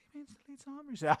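high, very fast squeaky voice; squeaky mock voice.

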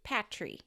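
"Patry" with a short a, as in pat; their pronunciation, Patry. Shown as a demonstration. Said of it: "they say it".

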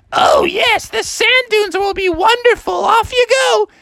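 high, singsong delighted mother voice; gushing mom. Acted. Mom sending Froggy to the dunes, high and gushing. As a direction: gush brightly and shoo him along.

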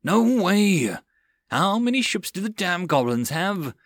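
fuming. Acted. John fuming at the new goblin fleet, outburst. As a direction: vent your frustration at the odds. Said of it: "de John fumed".

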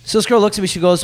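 ordinary delivery

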